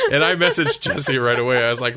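usual speaking voice

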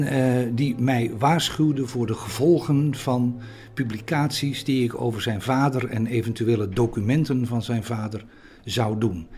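ordinary talk

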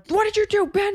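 high-pitched exasperated demand; imitated scolding dad. Dad confronting his little son, imitated scolding. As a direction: re-enact a father's heated telling-off. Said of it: "my dad was like".

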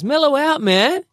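high, put-on gangster voice; Diaz impression. Quoting the villain Diaz to the guys, pitched high. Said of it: "Diaz goes".

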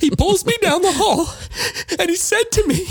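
high, hammed-up storyteller voice; lightly voiced scandalized gossip; dramatic retelling. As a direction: ham up the gossip. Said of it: "already it sounds bad".

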